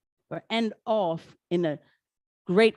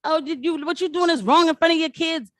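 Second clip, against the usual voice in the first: imitated scolding; 9 semitones higher, 4 dB louder than usual. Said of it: "people are yelling at this woman".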